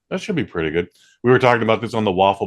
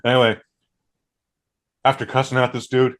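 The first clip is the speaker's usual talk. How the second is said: ordinary talk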